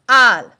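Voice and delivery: "al" said loud as one syllable; stress on al. Shown as a demonstration. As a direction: punch out "al" clearly. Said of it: "put it together and say".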